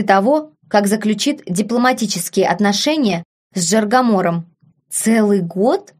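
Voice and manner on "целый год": drawn-out, hopeful question; joyful anticipation. A narrator's reading. Nephew learns how long she stays, gladly anticipating. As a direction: stretch it slowly with mild eager anticipation. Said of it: "В голосе Фабиана послышалось радостное предвкушение".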